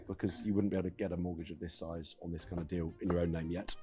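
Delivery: ordinary delivery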